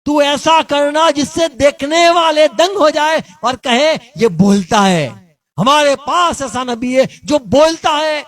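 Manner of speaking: high, loud sermon cry; a preacher rousing the congregation; fiery preaching. Acted. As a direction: preach it out at full force. Preaching at full force.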